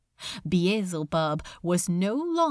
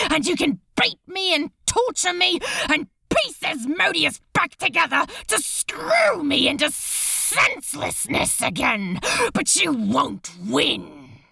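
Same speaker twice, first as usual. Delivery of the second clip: livid rage; 7 semitones higher than usual. Acted. Crowley raging at the demon torturing him, furious. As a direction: pour out defiance in a rage. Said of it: "livid with rage".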